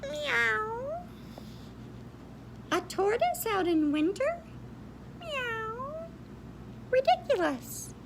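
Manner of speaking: high cat voice; a snooty cat sneering; high mewing cat voice; meowing cat. Acted. Cat character mocking tortoise.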